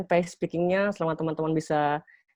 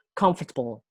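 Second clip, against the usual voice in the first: "comfortable" stressed on the first syllable, COM; 2 semitones lower than usual. Shown as a demonstration. Teacher demonstrating word stress, "comfortable" weighted on its first syllable. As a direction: lean on the opening "com" of "comfortable". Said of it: "word stress itu kita memberi penekanan".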